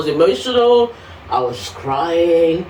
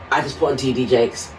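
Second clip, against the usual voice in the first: ordinary delivery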